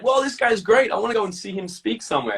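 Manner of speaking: thrilled discovery of a hero; excited younger self. Retold or imitated. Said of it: "And I was like".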